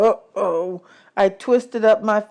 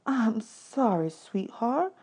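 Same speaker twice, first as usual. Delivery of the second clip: softer, slow consoling words; tender sympathy; 4 semitones higher, 7 dB quieter, much slower than usual. Acted. Singer comforting the boy about his mother, soft. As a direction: comfort him gently.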